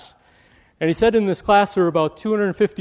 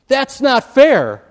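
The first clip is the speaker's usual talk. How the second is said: higher, louder, slow indignant whine; indignant cry; 8 semitones higher, 8 dB louder, slower than usual. Retold or imitated. Indignant student protesting grade: high loud whine. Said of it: "now they're indignant".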